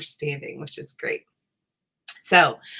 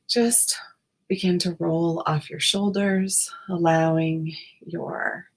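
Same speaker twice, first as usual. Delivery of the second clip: guided meditation. Acted. Guided meditation body scan.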